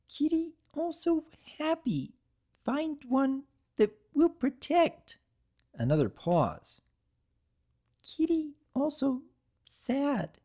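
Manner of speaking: put-on very high, small and childlike, words halting; a little cookie naming its feelings; tiny broken-speech creature.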